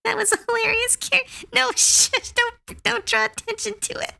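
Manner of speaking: giggling embarrassed protest; laughing, high and fast. Real, not acted. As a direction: squeal it out through laughter. Laughing, telling someone to shush.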